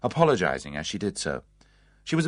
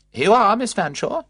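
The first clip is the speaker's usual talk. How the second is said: high, bright, louder hello; bright greeting; 12 semitones higher, 8 dB louder than usual. A narrator's reading. Matron brightly presenting the nephew to the old aunt. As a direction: announce the visitor cheerfully. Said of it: "she said brightly".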